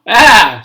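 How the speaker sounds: loud bleating baa; loud sheep baa. A narrator's reading. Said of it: "said the sheep".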